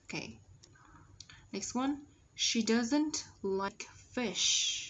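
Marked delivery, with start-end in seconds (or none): 2.4-4.8 slow model with clear sh in "She" and "fish"; careful teacher's reading, strong sh in "fish"; SH sounded. Shown as a demonstration. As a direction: say it word by word, ending "fish" on a full sh. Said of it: "S H là dấu hiệu của âm".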